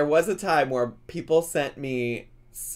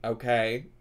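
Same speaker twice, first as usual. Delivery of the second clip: ordinary delivery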